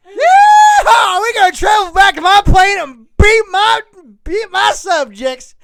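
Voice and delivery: loud, high Southern hillbilly shouting through laughs. Acted. Starfire boasts in a laughing Southern hillbilly holler. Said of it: "the hillbilly voice".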